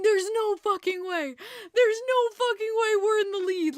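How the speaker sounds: loud, high shout of disbelief; laughing disbelief shout. Retold or imitated. Fan shouting at the TV in disbelief, loud. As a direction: yell it in stunned joy. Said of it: "holding my stomach going".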